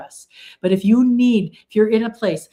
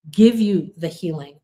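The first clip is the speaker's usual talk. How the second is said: normal talking voice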